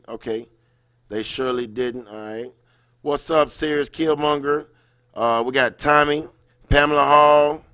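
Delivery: ordinary talk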